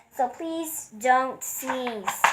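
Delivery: slow, drawn-out plea; hippo's slow plea; pleading. Acted. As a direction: stretch it out, begging. Hippo begs the elephant.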